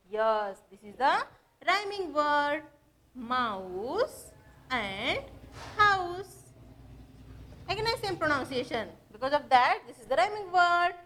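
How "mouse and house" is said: slow, separated modelling. Shown as a demonstration. Teacher showing pupils a rhyming word pair, slowly separated. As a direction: draw out Mouse and, then land on house.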